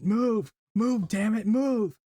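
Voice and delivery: half-shouted urging to himself; retold inner scream. Ichigo urging himself in his head, retold as a mild scream. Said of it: "screaming at himself in his head".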